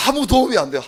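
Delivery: a pastor ruing that wrestling is useless; emphatic exclamation. Real, not acted. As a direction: exclaim it in frustration.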